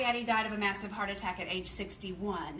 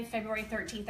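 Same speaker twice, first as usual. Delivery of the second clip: ordinary talk, nothing marked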